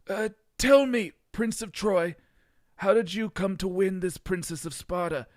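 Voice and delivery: a grand, hammed-up pharaoh greeting; terrible pharaoh accent. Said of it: "Seti, the pharaoh ... said".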